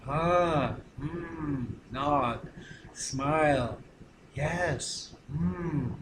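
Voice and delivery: soft, low affirming murmurs; quiet, unhurried acknowledgement; attentive listening. Shown as a demonstration. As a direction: demonstrate gentle listening, with space between responses. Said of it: "then you do this".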